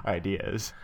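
normal talking voice

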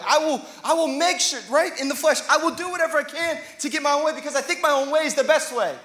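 loud, driving preacher cry; full-force preaching. Acted. Pastor pleading with the church, loud and driving. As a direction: lift the room with it.

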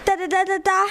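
high, stammering fright; panicked. A narrator's reading. Pupsi bursts from the bush after seeing a lion, panicked. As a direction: stutter it out in a panic. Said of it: "mit panischem Gesicht".

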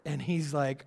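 ordinary delivery

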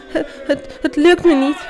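acted high, halting sobs; sobbing. A narrator's reading. Felix gives up in the arena, sobbing. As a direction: stammer it through mock tears, high-pitched. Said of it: "barstte in snikken uit".

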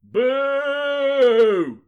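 loud, high, drawn-out boo; long high-pitched heckle from the townsfolk; bellowed booing. Acted. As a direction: jeer like an angry crowd. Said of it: "bellowed the townsfolk".

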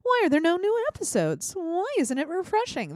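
high-pitched mock whiny listener; mock complaint in a high voice; complaining listener. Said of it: "you're like".

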